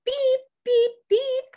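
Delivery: high, bright "Beep, beep, beep" like a toy horn; playful horn sound for the kids; truck horn. Pretend. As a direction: honk like a little truck. Truck honks in the story.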